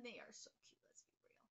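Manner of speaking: a breathy whispered aside, almost no voice. Real, not acted. Whispering an aside to the viewers about the neighbours. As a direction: lean in and whisper it.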